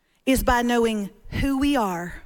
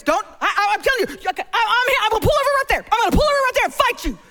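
flustered mock threat; 7 semitones higher, much faster than usual. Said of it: "David goes".

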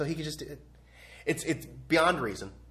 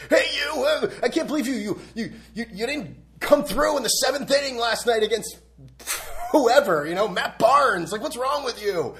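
loud, higher-pitched mock tirade; heckling New York fan; 9 semitones higher, 6 dB louder than usual. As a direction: berate a player like an outraged fan. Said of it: "in New York and be like".

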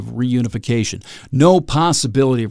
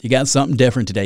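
normal talking voice

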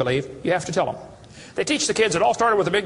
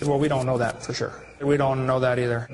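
a lower, hesitant professor; professor's voice; 6 semitones lower than usual. Retold or imitated. Professor admitting ignorance to the questioner, lower. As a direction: drop down into the cornered professor. Professor's answers quoted back.

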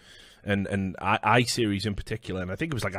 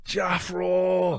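calling out; 9 semitones higher than usual. Retold or imitated. Calling out a name.

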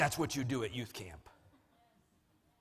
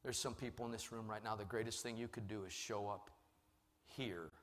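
soft, low closing appeal; quiet pastoral invitation, nearly whispered at times; hushed altar call; 3 semitones lower, 9 dB quieter than usual. Real, not acted. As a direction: drop to an intimate, sincere hush.

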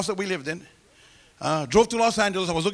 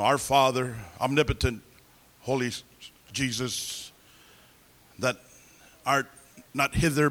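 low, halting mock-liturgical voice; big solemn prayer; 6 semitones lower, slower than usual. Imagining the prayer he expected for himself, mock solemn. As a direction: intone a grand prayer, word by word. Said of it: "gonna be a big prayer like".

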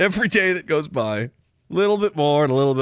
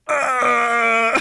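high, strained mock groan; 6 semitones higher than usual. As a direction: groan like someone holding it all in. Imitates CPC bros in denial.

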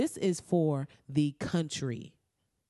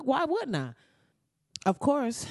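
usual speaking voice

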